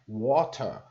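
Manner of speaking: British water with a crisp T; British English T. Shown as a demonstration. Teacher showing British English, water with a clear T. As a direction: say water the British way, T fully sounded. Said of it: "ele pronuncia bastante o T".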